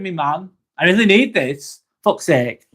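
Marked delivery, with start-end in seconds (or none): none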